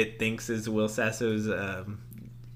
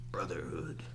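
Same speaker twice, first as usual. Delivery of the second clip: whisper; 6 semitones lower, 12 dB quieter than usual. Acted. Whispered title follow-up.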